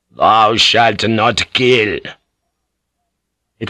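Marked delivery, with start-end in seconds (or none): 0.1-2.0 slow, deliberate commandment; solemn priestly command. Acted. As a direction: intone it slowly, forbidding them. Priest steps in against killing.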